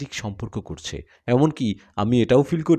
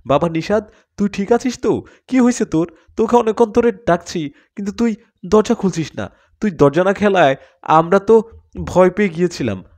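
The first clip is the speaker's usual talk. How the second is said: higher, anxious, rushed worry over a son; frantic parent's fear, pitched up; worried parent; 7 semitones higher, 5 dB louder than usual. A narrator's reading. Parents find their fainted son, frantic and worried.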